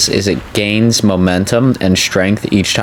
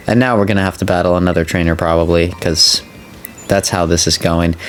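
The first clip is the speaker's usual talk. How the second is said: normal talking voice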